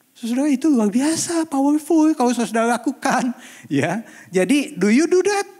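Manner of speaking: high, loud exhortation to the congregation; forceful preaching. Real, not acted. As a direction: drive each phrase home at full voice.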